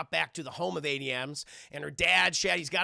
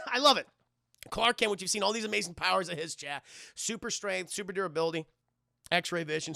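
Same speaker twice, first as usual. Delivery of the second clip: ordinary talk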